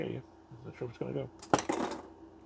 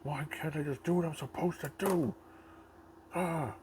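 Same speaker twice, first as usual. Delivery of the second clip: whiny orc chief; 4 semitones higher than usual. Pretend. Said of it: "He's like".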